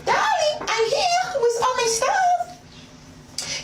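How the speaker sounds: high-pitched, hammed-up French accent. Woman arriving at her date's house with luggage, hammed-up French accent. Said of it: "I spoke in a French accent".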